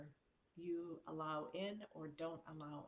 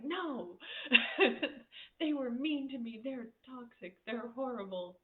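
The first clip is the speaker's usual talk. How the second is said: higher, louder whiny complaint through laughter; laughing mock whine; 7 semitones higher, 9 dB louder than usual. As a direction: ham up a hurt rant while laughing. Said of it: "you could ask me ... and be like".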